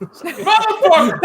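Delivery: high, loud laughing yell; laughing mock yell. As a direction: yell it in fake outrage. Mock outrage after losing the vote.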